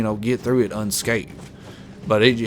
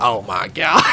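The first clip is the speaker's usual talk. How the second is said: a mock shocked cry of disbelief; mock shocked girlfriend. Future girlfriend hearing it's a Korean film, mock shocked. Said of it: "She's gonna be like".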